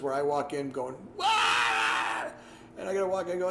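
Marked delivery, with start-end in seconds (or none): 1.2-2.2 sudden high shout of fright; startled yell. Retold or imitated. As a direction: jump and yell in surprise. Startled scream retold.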